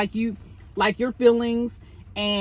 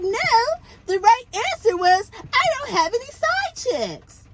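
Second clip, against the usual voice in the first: put-on much higher little-kid voice; baby voice; 12 semitones higher than usual. Host mocks Kimmy quizzing Maurice in a baby voice. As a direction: put on a childish voice, like a little girl. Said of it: "trying to talk like this little kid, baby voice".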